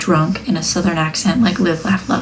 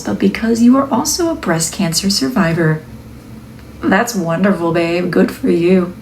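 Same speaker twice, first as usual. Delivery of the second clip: upbeat narration for a product video; video voiceover. Acted. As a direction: read it like a cheerful product reveal. Reads the product voiceover script.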